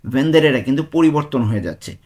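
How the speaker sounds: ordinary talk, nothing marked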